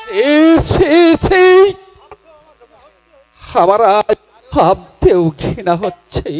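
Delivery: disgusted. Acted. King disgusted at daughter.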